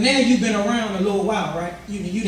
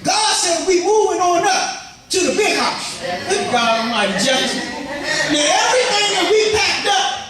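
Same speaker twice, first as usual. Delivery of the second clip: preaching at full force; 6 semitones higher than usual. Acted. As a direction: rouse the congregation, voice climbing and ringing.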